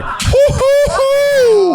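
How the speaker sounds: loud high-pitched whooping hoots; celebratory falsetto hoots; high hooting whoops. Retold or imitated. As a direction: whoop like a party crowd, way up high. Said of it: "I'm like".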